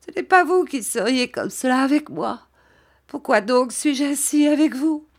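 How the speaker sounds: high, tearful self-blame; acted weeping, pitched well up; heartbroken crying. A narrator's reading. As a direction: blame yourself as if your heart were breaking. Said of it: "elle pleurait toujours, comme si son cœur allait se briser".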